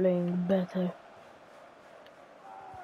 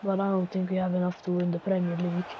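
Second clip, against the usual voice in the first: ordinary talk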